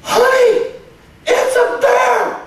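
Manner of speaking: high, loud comic holler to a spouse; excited tourist shouting. Acted. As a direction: call out in over-the-top tourist excitement. Acted excited tourist calling spouse.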